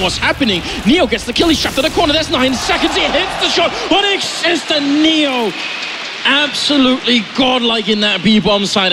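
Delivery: rising, excited play-by-play call; esports caster. Acted. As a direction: build the hype of a live clutch. Live play-by-play hype.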